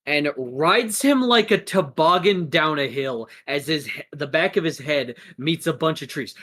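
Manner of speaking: ordinary talk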